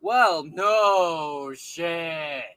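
very slow, drawn-out mock agreement; sarcastic drawl. Real, not acted. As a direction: stretch it out with scorn. Sarcastic reaction to clip.

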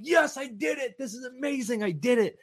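high, shouted celebration fading down; triumphant cheer. Retold or imitated. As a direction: whoop it out like you just won. Celebrating in the car.

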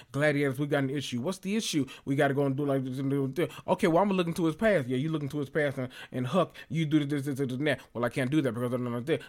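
fast back-and-forth, parodying drama characters; rapid-fire mock drama banter; rushed TV dialogue. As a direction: race through both sides of the exchange. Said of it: "dialogue between characters and it's rushed".

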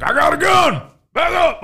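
high-pitched imitation of a threatening dad; acted-out alarmed shout; mock dad yell. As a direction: yell a warning like an armed dad. Imitates the dad confronting intruder.

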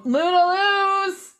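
high, slow voice to a pet; talking to the dog. Real, not acted. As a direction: coo at the dog.

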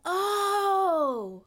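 long, high "oh" of understanding; sudden realisation. Acted. Elf catches her colleague's hint, dawning realisation. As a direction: let the penny drop in a drawn-out oh.

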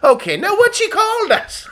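high, laughing Irish mammy; lightly voiced proud mother, giggling; mock family voice. Mocking Brendan's family watching the news, high and laughing.

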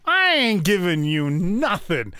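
high, loud sassy retort; sassy outburst. Retold or imitated. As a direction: snap back with attitude. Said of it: "I'm like".

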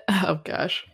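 ordinary delivery

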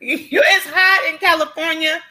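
mock whine. Complains about heat, whiny.

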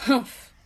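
short, loud grumpy huff; grunted humph. A narrator's reading. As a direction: huff it out like a storybook grouch. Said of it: "grunted Mister Grumpy".

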